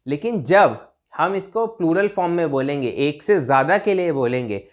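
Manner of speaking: normal talking voice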